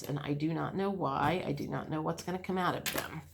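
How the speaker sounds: muttering to herself over the cards; low murmur while choosing decks; quiet thinking aloud. Real, not acted. Reader muttering to herself while picking tarot cards, quiet.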